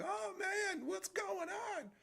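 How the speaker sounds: high, loud mock-panicked shout; panicked visitor. Newcomer reacting to a giant beatdown, high mock-panicked shout. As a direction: cry out in exaggerated alarm, drawing the words out.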